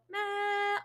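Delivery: long held "meh" imitating the instrument; steady sustained drone. Shown as a demonstration. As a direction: keep the air going evenly through the sound. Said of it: "the air stayed the same".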